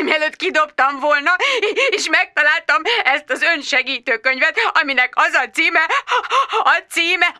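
high, slowed, tearful complaint; tearful friend. Acted. Jude sobbing down the phone to Bridget. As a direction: tell it near sobbing. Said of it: "zokogott birka hangon".

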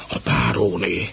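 frightened whispered warning; a servant whispering that the baroness is here; startled whisper. Acted. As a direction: hiss the warning in alarm. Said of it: "súgta ilyetten valaki".

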